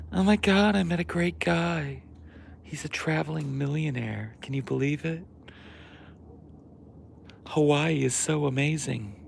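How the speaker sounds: put-on high, excited valley girl; girly voice. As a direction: gush like a smitten tourist. Mocking the girl in Hawaii.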